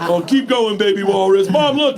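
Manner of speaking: high, loud imitation of jeering girls; shrieky mock taunt; mocking daughters. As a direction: egg him on in a kid's teasing voice. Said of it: "my daughters just videotaped me and laughed".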